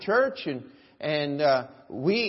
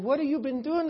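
mock incredulous, high-pitched question; 8 semitones higher, faster than usual. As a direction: imitate a taken-aback chaplain, pitched up. Acts chaplain questioning the inmate.

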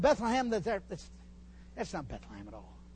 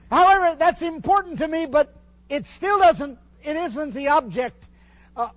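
raised, higher and louder preaching; fervent preaching; 6 semitones higher, 10 dB louder than usual. Acted. Preacher telling the congregation his faith, raised and higher. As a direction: drive the point home from the pulpit.

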